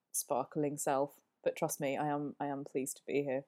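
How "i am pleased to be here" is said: ordinary talk, nothing marked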